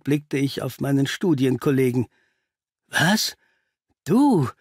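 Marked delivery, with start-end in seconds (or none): 2.9-4.5 stunned, halting outburst; stammering shock. A narrator's reading. Watson recognizes the intruder, stammering in shock. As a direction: trip over the words in disbelief. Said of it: "stammelte ich".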